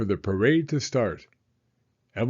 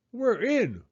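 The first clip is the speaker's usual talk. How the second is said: woofed reply; 11 semitones higher than usual. Acted. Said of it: "woofed Bailey".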